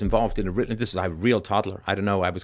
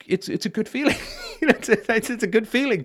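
high giggling through the words; laughing; 11 semitones higher than usual. Real, not acted. Telling his mom helping people feels good, laughing. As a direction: laugh it out, half embarrassed.

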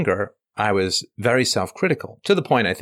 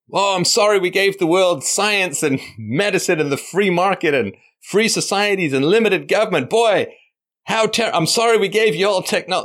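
sarcastic, higher, over-sorry; mock apology; 6 semitones higher than usual. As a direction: ham up the guilty apology.

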